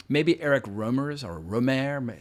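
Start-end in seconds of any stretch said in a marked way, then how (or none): 1.4-2.1 "Rohmer" said the French way, ro-MAIR; "Rohmer" ending like "mare"; French pronunciation. Shown as a demonstration. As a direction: attempt a French "Rohmer", stressed on the second syllable. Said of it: "never quite know with the French pronunciation".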